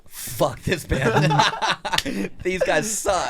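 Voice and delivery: high, slow laughing jeer; mock hater. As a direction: sneer it like a troll. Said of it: "have everyone be like".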